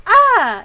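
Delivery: sudden loud high scream of shock; a loud startled yelp of horror. Retold or imitated. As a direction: shriek it out in disbelief. Shocked reaction to date's confession.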